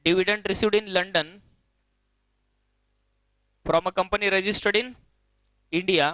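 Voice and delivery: slow dictation, long pauses between phrases. Real, not acted. Teacher repeating the line for students writing, slow dictation. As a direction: dictate slowly, leaving gaps for writing.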